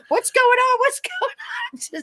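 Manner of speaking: high alarmed cry, breaking into a laugh; panicked kid. Retold or imitated. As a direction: shout it out, confused and scared, then laugh. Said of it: "And I'm going".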